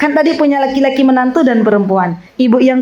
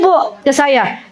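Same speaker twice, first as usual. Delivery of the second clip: normal talking voice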